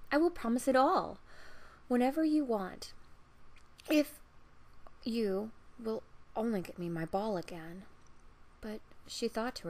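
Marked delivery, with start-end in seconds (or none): 0.1-7.9 eager promise. Acted. Princess eagerly promising the frog everything. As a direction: promise anything, impatient.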